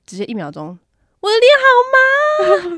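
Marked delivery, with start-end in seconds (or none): 1.2-2.8 high, loud, slow wail of panic; hammed-up panicked squeal; panicked cry. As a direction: shriek that your face is numb. Stung by bee, crying out.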